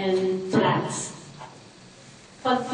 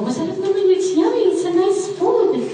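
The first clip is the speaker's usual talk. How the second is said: put-on higher and brighter, selling the food to a child; bright coaxing; 9 semitones higher, 3 dB louder than usual. Shown as a demonstration. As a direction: sound delighted to coax a picky kid. Eating along with the kid. (masiglang panghihikayat sa bata)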